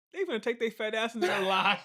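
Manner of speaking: loud laughing disbelief. Real, not acted. Laughing at TV show.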